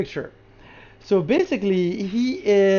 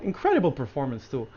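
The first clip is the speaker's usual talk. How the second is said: ordinary talk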